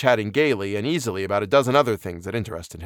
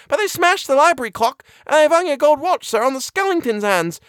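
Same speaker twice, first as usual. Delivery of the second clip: very high, louder flustered housekeeper; breathless exclamation; 5 dB louder than usual. A narrator's reading. Housekeeper panting upstairs behind the doctor, breathless exclamation. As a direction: exclaim it in a high, agitated put-on voice. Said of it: "she exclaimed breathlessly".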